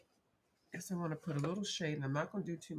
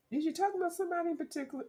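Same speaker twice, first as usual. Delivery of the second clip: mock imagined viewer; 14 semitones higher, 4 dB louder, much faster than usual. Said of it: "No, I'm talking about anybody".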